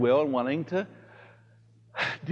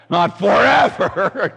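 louder, slow, through laughter; laughing; 5 semitones higher, 7 dB louder, slower than usual. Real, not acted. Priest laughing about his own yelling.

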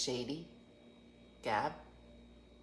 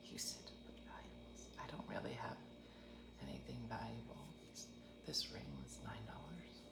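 hushed whisper, barely voiced; 10 semitones lower, 24 dB quieter than usual. Whispering her answer to the tour guide. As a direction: whisper it like a secret aside.

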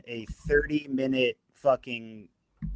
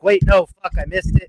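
sudden louder, higher panicked cry; retold frantic outburst; 5 semitones higher, 11 dB louder than usual. Telling friends how he panicked over missing plays, loud startled outburst. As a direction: blurt it out like you just missed the play. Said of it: "and then I was like".

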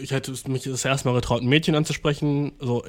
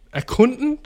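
high, incredulous echo of the word; mocking disbelief; 9 semitones higher than usual. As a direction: throw the word back mockingly. Mocks word from listener letter. (spöttisch ungläubig)

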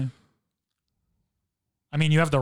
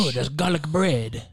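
drawn-out mock French accent; playful fake French; slower than usual. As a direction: stretch it out in silly French. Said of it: "doing my French accent".

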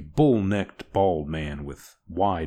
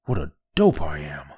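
louder self-disgusted snarl; 4 dB louder than usual. Acted. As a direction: spit "What a dope I am" at yourself. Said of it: "snarled Orn".

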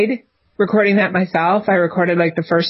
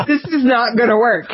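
laughing it off; 4 semitones higher than usual. Retold or imitated. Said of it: "And I'm like".

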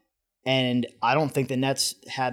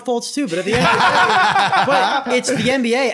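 laughing rant; 9 semitones higher, 7 dB louder than usual. Real, not acted. Heated fan rant, laughing.

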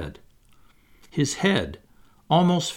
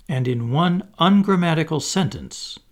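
ordinary delivery

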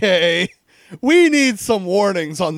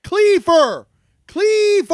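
excited shout; 12 semitones higher, 4 dB louder, slower than usual. Real, not acted. Shouting game character name.

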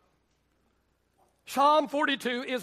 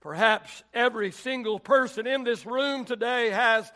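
higher, rising sermon cadence; preaching at full force; 3 semitones higher, 3 dB louder than usual. Acted. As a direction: press the point home to the church.

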